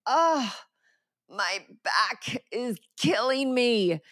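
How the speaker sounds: dramatic groaning complaint. Retold or imitated. Aching speaker groans alone in hotel bathroom, dramatic complaint. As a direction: complain with mock agony, stretching the words. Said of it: "language and tone".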